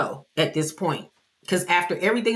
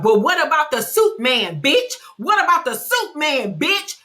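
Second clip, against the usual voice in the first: taunting retort; 7 semitones higher than usual. Retold or imitated. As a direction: hurl it back as a taunt. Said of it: "And Lisa is like".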